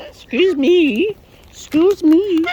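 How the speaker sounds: high, loud, mock-offended cry, first slow; high, loud mock indignation; indignant excuse me. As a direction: squeal it at the cheeky bird in mock outrage. Birds grabbing at her.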